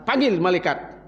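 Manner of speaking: normal talking voice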